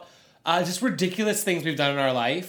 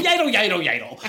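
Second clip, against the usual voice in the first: silly dance noise; 5 semitones higher, 6 dB louder than usual. Pretend. Silly stocky dance sound.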